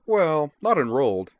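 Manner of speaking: high, loud, conceding; Edward. A narrator's reading. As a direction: admit it in a high pipe. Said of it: "declared in a high voice".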